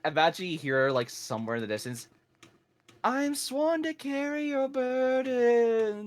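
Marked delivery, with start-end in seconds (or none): 3.0-6.1 slow, weary, high-pitched sigh of a line; tired Lydia voice. Retold or imitated. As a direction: drag it out like an exhausted housecarl. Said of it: "In the most tired voice possible".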